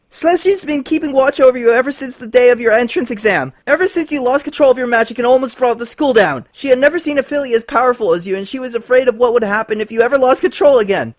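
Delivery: high, loud, agonized confession; pained shouting. Acted. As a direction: shout it through agony. Lyra screaming under attack.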